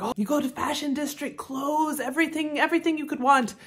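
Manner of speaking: eager Thai local listing attractions, lightly imitated; mock Thai accent. Local man selling a tourist on shopping, light mock Thai accent. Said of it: "go into a bit of an accent".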